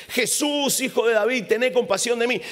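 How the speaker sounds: clamor agudo y fuerte; grito suplicante. Acted. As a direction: gritar pidiendo compasión. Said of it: "empieza a gritar, da gritos y voces".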